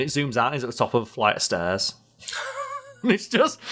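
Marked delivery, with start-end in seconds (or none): 2.3-2.8 squeaky burst of laughter; high-pitched laugh. Real, not acted. Laughing at the joke.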